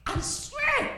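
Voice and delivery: very high, soft squeaked oath; mock swearing. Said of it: "He swore. He said".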